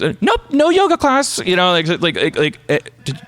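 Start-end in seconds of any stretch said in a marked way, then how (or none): 0.0-1.4 much higher, put-on voice of the therapist; a mock therapist voice, pitched high. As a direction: play the therapist with a high, breezy voice. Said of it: "She goes".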